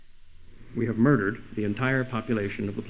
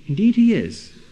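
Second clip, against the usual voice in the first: Indeed he is, thrown out louder and higher with weight on he; emphatic loud reply; 8 semitones higher, 6 dB louder than usual. Real, not acted. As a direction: hit it hard, like slamming down the final word. Answers the objection again, emphatically.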